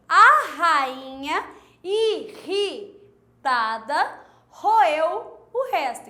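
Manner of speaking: slow, loud, leaning on the R sounds; slow clear reading. Shown as a demonstration. Teacher reading the second line slowly for pupils. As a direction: read it slowly, marking each R. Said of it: "na frase de baixo".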